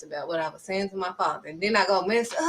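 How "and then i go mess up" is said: usual speaking voice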